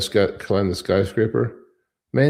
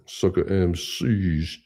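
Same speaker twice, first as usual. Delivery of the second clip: slow drawn-out; much slower than usual. Pretend. Host riffing on a song title, slowly. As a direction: stretch it out slowly, word by word.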